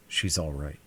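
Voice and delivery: the rival answers the brother, low and even; low stranger's voice. Acted. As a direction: drop into the cool stranger. Stranger reassures about the girl.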